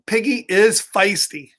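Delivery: ordinary delivery, nothing marked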